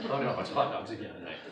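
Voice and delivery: laughing groan. Real, not acted. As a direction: laugh through the complaint. Laughing at hot dog memory.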